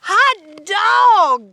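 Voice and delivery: loud, high shout of joy; excited cry. A narrator's reading. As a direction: whoop it out like thrilled kids. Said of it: "cried the class".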